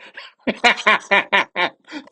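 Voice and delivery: loud burst of real laughing; real laughter. Real, not acted. Podcaster hearing the caption on the suspect's T-shirt, real laughter. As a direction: crack up in a rapid, loud laugh.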